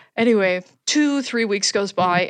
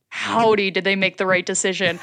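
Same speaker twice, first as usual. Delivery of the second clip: normal talking voice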